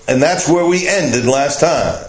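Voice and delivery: normal talking voice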